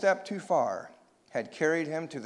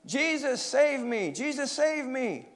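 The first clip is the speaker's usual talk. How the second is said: high-pitched desperate plea; wailing storybook prayer, pitched high; crying out; 10 semitones higher, slower than usual. A narrator's reading. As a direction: cry to heaven for rescue. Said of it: "and cried".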